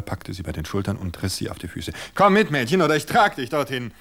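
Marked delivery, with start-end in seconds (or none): 2.1-3.9 loud, high, falsely jolly threat; jolly on top, cruel underneath, much louder and higher; mock-cheerful menace. Husband seizing his wife, loud mock-cheerful menace. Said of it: "Die Worte sollten fröhlich klingen, aber ... wilde Grausamkeit".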